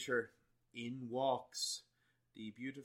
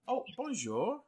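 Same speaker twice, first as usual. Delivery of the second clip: put-on French shop girl; 14 semitones higher, 5 dB louder, slower than usual. Shop girl greets the party.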